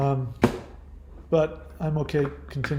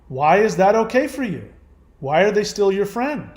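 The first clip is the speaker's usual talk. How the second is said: ordinary talk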